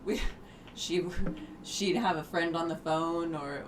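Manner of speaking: usual speaking voice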